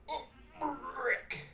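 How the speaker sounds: burpy drunken cartoon voice; Rick. Shown as a demonstration. Showing how a drunk person does the voice, burping through it. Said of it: "you got more of them burps and stuff like Rick".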